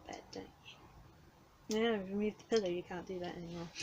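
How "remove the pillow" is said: usual speaking voice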